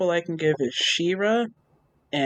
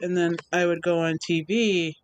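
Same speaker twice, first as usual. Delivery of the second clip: ordinary talk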